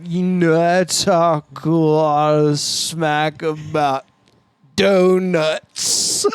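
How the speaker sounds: slow, drawn-out podcast at half speed; imitated slowed-down recording, sounding stoned; half-speed playback. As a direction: stretch every word as if played at half speed. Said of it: "it sounded like".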